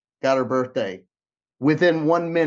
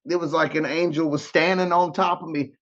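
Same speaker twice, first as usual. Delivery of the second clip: ordinary talk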